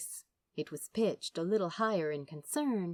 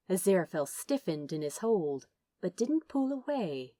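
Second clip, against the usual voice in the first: ordinary delivery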